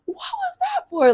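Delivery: very high-pitched mock confrontation; mock challenging kid. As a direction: imitate a kid daring to question an adult, way up high. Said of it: "I was never that kid to be like".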